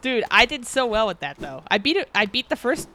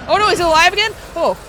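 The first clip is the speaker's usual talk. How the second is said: startled high yell; real loud cry of alarm; a sudden shout at the boss coming back; 8 semitones higher, 8 dB louder than usual. Real, not acted. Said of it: "I'm sorry, I yelled".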